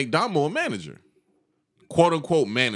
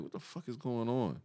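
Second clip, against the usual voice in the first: hushed confusion; 2 semitones lower, 13 dB quieter than usual. Retold or imitated. Confused under his breath.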